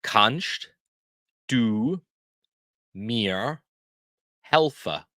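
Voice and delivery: one word at a time, long pauses between; spaced out slowly for learners to repeat; word-by-word teaching demo. Shown as a demonstration. Teacher shows learners how to ask for help, word by word. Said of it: "How we say that".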